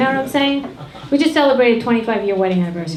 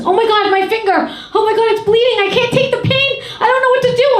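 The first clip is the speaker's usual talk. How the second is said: high, loud panicked wailing over a cut finger; hammed-up hysterical whining; panicked yelp; 11 semitones higher, 5 dB louder than usual. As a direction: shriek it like a drama queen in pain. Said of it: "and he goes".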